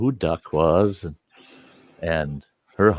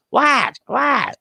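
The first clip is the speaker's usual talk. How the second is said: high-pitched, clueless mimicry; mocking imitation. Man mimics bowler's cluelessness back at her, mocking. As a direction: parrot the question back in a high voice. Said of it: "you're standing there with the ball".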